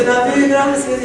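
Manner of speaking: loud, high, slow cry of thanks; half-sobbed gratitude, voiced by the storyteller; tearful thanks. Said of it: "empieza a llorar más este hombre". (gracias entre lágrimas, alto y lento)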